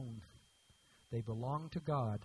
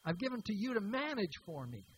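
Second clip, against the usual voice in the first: indignant investor; 6 semitones higher than usual. Retold or imitated. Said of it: "He said".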